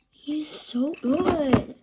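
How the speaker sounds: slow, drawn-out delighted praise; excited. Real, not acted. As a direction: stretch it out in awe. Admiring the figure just unboxed.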